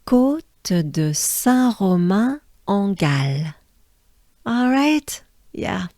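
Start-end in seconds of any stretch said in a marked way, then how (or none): 0.0-3.5 Côte de Saint-Romain-en-Galles drawn out in French; slow French demo. Shown as a demonstration. Teacher leading the class through the whole name, slowly. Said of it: "Let's take a good breath".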